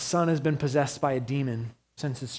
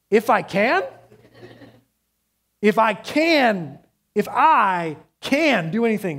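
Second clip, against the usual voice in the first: loud, raised echo of the father's own words, slowing down on the repeat; incredulous retort; 5 semitones higher, 5 dB louder, slower than usual. Retold or imitated. Jesus repeating the father's doubt back to him in loud disbelief.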